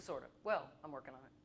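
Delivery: ordinary talk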